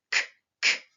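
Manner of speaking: K as a short voiceless click, no vowel; crisp phonics K; isolated K sound. Shown as a demonstration. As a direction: say just the consonant K, then pause and repeat it. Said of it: "mon pouce ne touche pas mon menton et ça fait".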